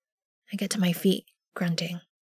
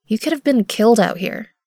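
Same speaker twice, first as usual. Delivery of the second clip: scolding; 3 semitones higher, 7 dB louder than usual. A narrator's reading. Scolding the child.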